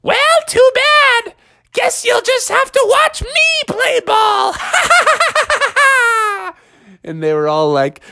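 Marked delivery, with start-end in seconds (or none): 0.0-4.6 very high, squealed taunt; high-pitched bratty crowing; gloating baby frog. Acted. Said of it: "the baby was like". 4.6-6.5 very high, long staccato laugh; squeaky villainous baby giggle; gloating baby cackle. Acted. Baby frog gloats after taunt.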